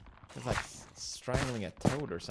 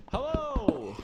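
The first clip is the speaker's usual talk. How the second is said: calling out; 5 dB louder than usual. Real, not acted. Greeting villagers from afar.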